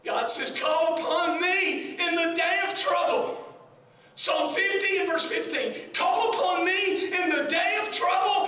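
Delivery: high, ringing scripture proclamation; full-force preaching. Real, not acted. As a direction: proclaim it to the whole church.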